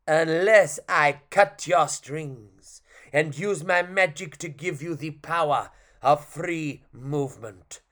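put-on slow, grand French-accented pronouncement. Clown offering the puppet magic, slow French accent. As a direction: declare it slowly, French accent. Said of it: "which is French".

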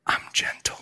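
soft, hushed whisper retelling God's voice. Retold or imitated. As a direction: whisper it very gently. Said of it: "I heard a whisper".

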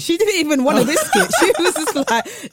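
laughing through the words; giggling as she tells it; amused, breaking into laughter. Real, not acted. Laughing while telling mum story.

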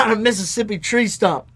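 tossing off a folksy Southern simile; a hint of a Southern US accent. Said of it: "he puts on the Southern accent".

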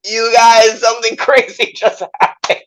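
high, loud, laughing news; giddy announcement. Retold or imitated. Actor telling castmates his news, giddy and high. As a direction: burst in with giddy excitement. Bursting into dressing room.

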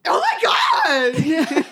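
very high, drawn-out squeal of an animal cry; armadillo noise. Pretend. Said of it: "of course they do".

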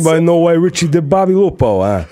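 Italian-American gangster demanding; Italian-American accent. Shown as a demonstration. Movie gangster accent asking the room. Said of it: "the deep Italian one".

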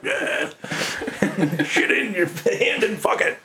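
high, laughing mock punk singer growl; crude punk singer. Mimicking the band's singer for the hosts, half laughing. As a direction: ham up a gross punk frontman, laughing. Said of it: "really super crude band".

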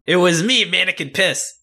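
hammy villain self-introduction, laughing; villain reveal. Pretend. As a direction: announce yourself like a cartoon villain. Villain reveal line acted.

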